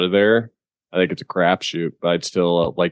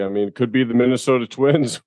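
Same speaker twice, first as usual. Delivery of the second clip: higher pitch, about to crack up; barely holding back laughter; 5 semitones higher than usual. Real, not acted. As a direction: try to get it out without laughing. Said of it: "without laughing".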